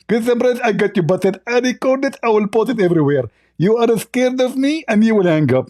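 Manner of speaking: high, lisping mock gloating; Zakir Naik. Mimicked opponent boasting he busted the host, high lisp. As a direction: crow in a high-pitched, lisping put-on voice. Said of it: "Hey, Zakir Naik".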